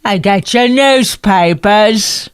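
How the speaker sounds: slow drawn-out hawker's cry; newsboy call. Pretend. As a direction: stretch out the paper call slowly. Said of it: "Is that how they did it".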